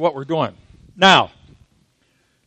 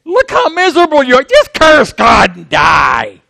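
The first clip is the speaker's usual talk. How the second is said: scornful friends' taunt; 5 semitones higher, 6 dB louder than usual. Retold or imitated. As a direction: sneer at the sufferer, then yell it out. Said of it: "they said".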